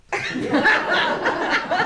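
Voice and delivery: very high giggle; high laugh. Real, not acted. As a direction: chuckle at your own joke. Laughs at own joke.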